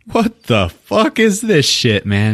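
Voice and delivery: high, slow incredulous squawk; disgusted outburst. Retold or imitated. Eggsy stares at the tiny condom, high slow disgust. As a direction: drag it out in disbelief.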